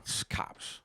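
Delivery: hushed unvoiced warning; whisper. Whispering to his friend in the car that it's the cops. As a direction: whisper it so nobody hears.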